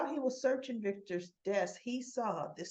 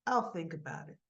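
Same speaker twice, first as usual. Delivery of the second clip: low, understated noncommittal reply; 4 semitones lower than usual. Retold or imitated. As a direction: give a guarded, offhand answer. Father answers daughter's plea.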